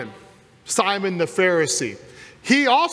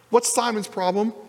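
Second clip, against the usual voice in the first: normal talking voice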